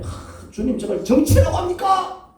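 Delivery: breathy lead-in into a high, loud cry; impassioned prayer to God. Acted. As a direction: cry out the grievance to the Lord. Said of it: "그런데 하나님 앞에". (주님께 부르짖어 따지듯)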